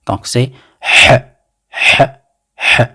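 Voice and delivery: teacher drilling H for learners; Arabic h sound. Shown as a demonstration. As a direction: hold each H as a long breath. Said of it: "c'est le même son".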